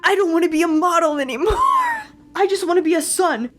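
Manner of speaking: laughing through a hammed-up fed-up yell; mock tantrum Adrien. Vlogger laughing while imitating Adrien's tantrum at his father. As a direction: quote Adrien's outburst half laughing, fast. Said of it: "Adrian getting so mad".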